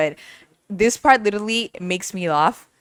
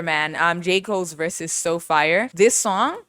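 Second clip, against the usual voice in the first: ordinary delivery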